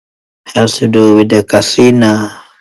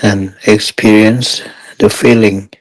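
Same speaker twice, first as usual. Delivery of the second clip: guided meditation. Real, not acted. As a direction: guide meditators step by step, unhurried and even.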